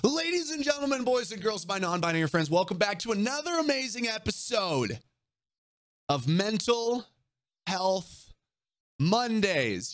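big hyped show host intro; ringmaster. Acted. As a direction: announce it like a ringmaster. Show opener hype announcement.

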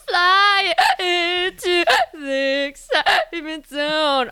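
laughing through the words; slow, giggling exclamation. Real, not acted. Cracking up at hiccup joke.

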